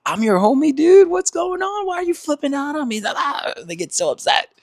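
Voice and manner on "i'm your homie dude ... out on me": high, startled protest; baffled friend. Retold or imitated. You to your livid friend, high startled protest. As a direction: back off, hands up, high and alarmed. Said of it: "You're like".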